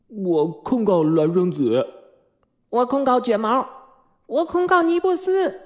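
put-on high-pitched childish complaints, building; tattling children. A narrator's reading. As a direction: report each other in rising piping voices. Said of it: "讨厌的告发又开始了".

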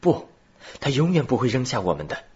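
firm. A narrator's reading. Robert firmly defending their friend to Glenarvan, firm. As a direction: insist firmly, quickly. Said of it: "罗伯尔坚定地说".

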